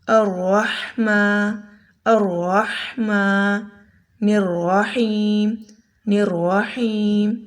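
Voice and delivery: الرحمن and الرحیم stretched very slowly; slow drill. Shown as a demonstration. Teacher drilling الرحمن and الرحیم very slowly. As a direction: drag out الرحمن الرحیم syllable by syllable. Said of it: "اس کی مثال لیجئے".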